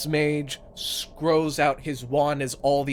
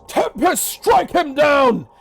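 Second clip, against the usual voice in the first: high, loud spell call, drawn out at the end; commanding shout; 12 dB louder, slower than usual. Acted. Wizard calling lightning on a pirate, commanding shout. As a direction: shout the storm down on your foe. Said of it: "As the wizard goes".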